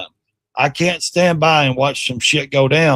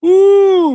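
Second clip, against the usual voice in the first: high, loud whoop; whooping at a knockout punch; excited whoop; 6 dB louder than usual. Real, not acted. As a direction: let out a big reacting "woo". Reacting to a knockout clip.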